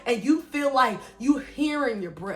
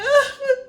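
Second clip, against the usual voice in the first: mock groan; 13 semitones higher, 6 dB louder than usual. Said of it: "I'm like".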